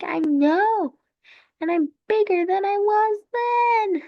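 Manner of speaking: high-pitched, playful brag; boasting France. Acted. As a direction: crow proudly as a cartoon country.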